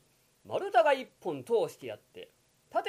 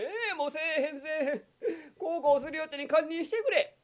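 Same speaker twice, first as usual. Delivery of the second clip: high tearful begging; crying plea; 10 semitones higher, faster than usual. Acted. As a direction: sob out the promise. Kokichi begs. (泣きながら謝る)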